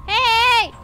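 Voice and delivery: sharp loud hey; loud shout. Real, not acted. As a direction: call out loudly. Shout in game.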